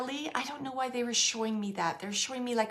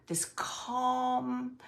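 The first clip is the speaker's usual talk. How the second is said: ordinary delivery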